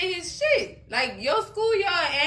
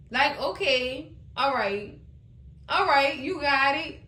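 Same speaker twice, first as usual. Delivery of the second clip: normal talking voice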